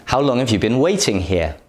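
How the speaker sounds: quick, connected natural speech; fast everyday question, words run together; fast natural example. Shown as a demonstration. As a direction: rattle the question off at natural speed. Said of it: "For example".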